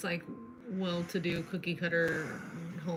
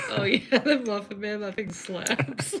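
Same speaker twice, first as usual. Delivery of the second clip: laughing mock enthusiasm; 2 semitones higher, 6 dB louder than usual. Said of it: "Nobody's like".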